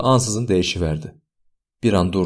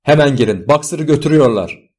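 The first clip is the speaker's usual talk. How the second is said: raised, alarmed call; louder, urgent warning cry; urgent shout; 5 semitones higher, 4 dB louder than usual. A narrator's reading. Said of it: "diye bağırdı Benjamin".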